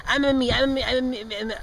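quick-fire repeats, letters running together; tongue-twister fast. Shown as a demonstration. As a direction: rattle M M E off rapidly. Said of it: "Try saying that's five times fast".

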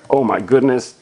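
ordinary delivery

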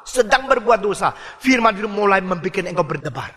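ordinary delivery

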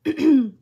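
loud, sharp throat clear; clearing a tickly throat mid-sentence. Real, not acted. Crafter apologizing to viewers for being coughy, loud throat clear. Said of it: "a little bit coughy today".

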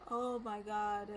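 ordinary delivery

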